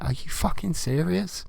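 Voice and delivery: high incredulous squeal; high, stunned mock outrage; mock disbelief. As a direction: gasp out utter disbelief, pitched high. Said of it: "it's like".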